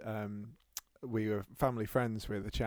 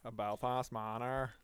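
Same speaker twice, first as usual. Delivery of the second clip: put-on Belfast accent; 7 dB quieter, slower than usual. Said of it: "in a Belfasty manner".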